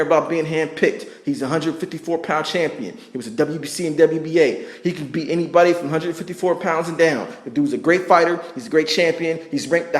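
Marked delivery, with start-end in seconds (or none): none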